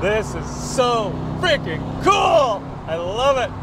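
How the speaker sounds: slow, loud, high shout of joy; amazed shout. Real, not acted. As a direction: yell it in pure delight. Shouting over engine.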